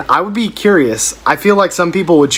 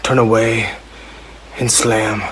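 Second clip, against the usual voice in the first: brooding northern English reading of pop lyrics. Pretend. Reading Let It Go lyrics as Jon Snow, lightly voiced northern English. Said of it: "reading lyrics from Let It Go as Jon Snow".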